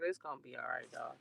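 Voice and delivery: soft, quiet reassurance; gentle soothing murmur; mock comforting. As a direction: hush it like comforting a crying kid.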